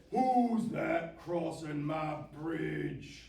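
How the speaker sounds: loud, slow troll challenge; big troll voice, drawn-out words; cranky troll. A narrator's reading. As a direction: bellow it slowly like a cranky troll. Troll challenges goat at bridge.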